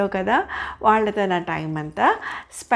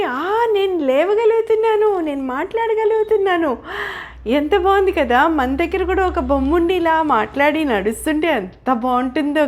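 put-on much higher, louder childlike joy; thrilled puppet; 7 semitones higher, 3 dB louder than usual. Pinocchio thrilled to stand and talk, high and loud. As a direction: squeal with wonder at moving.

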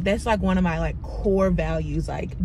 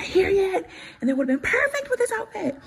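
high excited; 11 semitones higher than usual. Real, not acted. Showing outfit pieces to camera.

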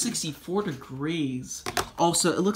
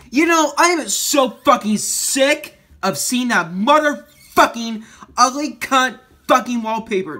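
shouted, much higher and louder, real anger at the wallpaper; furious rant; 8 semitones higher, 6 dB louder than usual. Real, not acted. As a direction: blow up at the screen in real rage. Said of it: "that's how annoyed I am".

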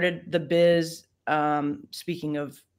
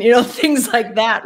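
laughing; 6 semitones higher, 8 dB louder than usual. Real, not acted. Laughing over stereotyped roles.

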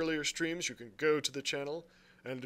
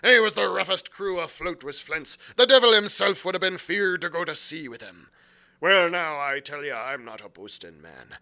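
put-on louder pirate brogue, boastful old sea cook; Long John Silver; 9 dB louder than usual. A narrator's reading. Silver boasting to the lad about Flint's crew, pirate brogue. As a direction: swagger it like a one-legged pirate cook.

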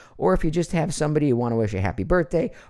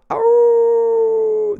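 long, very high sustained howl; wolf howl; 8 dB louder than usual. Pretend. Answering a fan's howling wolves request, with a long high howl. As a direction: howl like a lone wolf for the fan.

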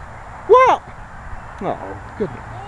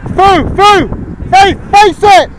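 higher, louder urgent shouts; yelling from the sidelines; 7 semitones higher, 4 dB louder, slower than usual. Real, not acted. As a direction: yell it across the pitch. Coach shouting instructions during play.

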